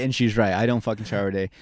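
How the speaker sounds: usual speaking voice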